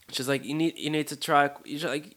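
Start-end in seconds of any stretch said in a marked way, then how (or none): 0.4-1.5 higher, mock British urging; bad British accent. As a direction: coax like an imitated British friend. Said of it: "sorry for this horrible accent".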